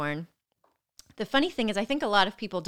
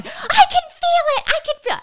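high, loud hammed-up shriek; retold screaming; 10 dB louder than usual. Sedated patient screaming at staff during stitches, hammed-up screaming. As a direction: imitate a pained scream, laughter creeping in. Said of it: "Why are you screaming at them".

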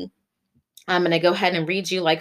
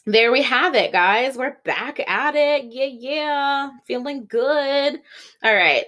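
upbeat; 7 semitones higher than usual. Real, not acted. Upbeat show restart after sip.

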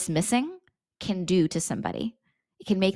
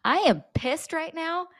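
high mock-angry outburst; pitched-up fed-up venting; 10 semitones higher than usual. Shown as a demonstration. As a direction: blurt it out like you're fed up. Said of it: "You just wanna say".

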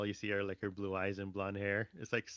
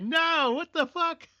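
far higher, louder hammed-up shriek; mock panicked yell; 10 dB louder, slower than usual. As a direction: squeal in mock panic at the spider. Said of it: "It was like".

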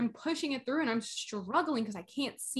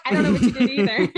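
laughing through the words, louder and fast; 11 dB louder, faster than usual. Retold or imitated. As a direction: giggle out the line. Retold reply, laughing.

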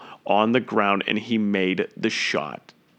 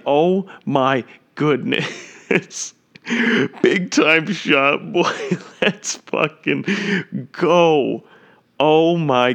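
real excited yell; 4 semitones higher, much slower than usual. Real, not acted. Commentator celebrating Shamet's tying three, ecstatic yelling. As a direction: erupt with joy at the big shot.

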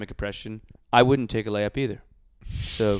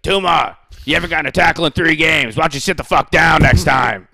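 higher and louder, mocking coach; coach's voice; 5 semitones higher, 13 dB louder than usual. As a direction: belittle the player in the film session. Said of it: "he's like".

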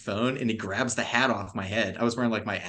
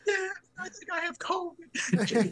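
very high, squealed fake sobbing; mock crying; slower than usual. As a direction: wail it pitifully, hamming up the tears. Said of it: "I started crying".

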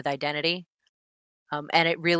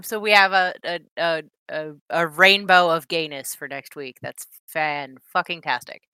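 ordinary talk

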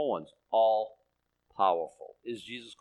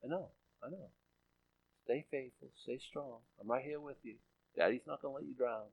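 very soft, soothing murmur; hushed comfort, as a daddy to his child; tender fatherly God; 11 dB quieter than usual. Acted. God to someone in a pressure cooker, tender reassurance. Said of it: "And he's like".

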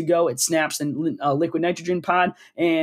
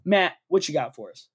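usual speaking voice

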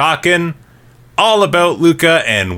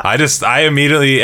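ordinary talk, nothing marked

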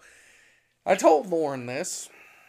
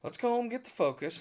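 lightly imitated mother, higher and quick; a touch of quick, higher-voiced mother; mother's voice; 7 semitones higher, 4 dB quieter, faster than usual. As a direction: hurry it out as Mom, pitched up. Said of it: "my mother looks at him and says".

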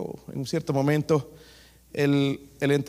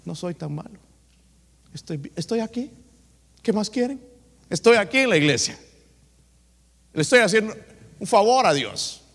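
higher, self-satisfied Pharisee boasting at church; a proud man who feels he's doing God a favor; smug Pharisee; 7 semitones higher, 4 dB louder than usual. Acted. Preacher voicing the smug Pharisee at the temple, higher and proud. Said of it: "Como este fariseo".

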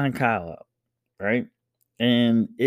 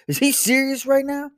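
put-on higher indignant kid voice. Older son protests to dad, high and indignant. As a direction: play the outraged older brother.

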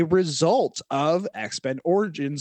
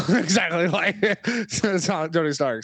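words broken up by chuckles; real laughter bubbling through the line; laughing while talking; slower than usual. Real, not acted. Cracks up at own comparison.

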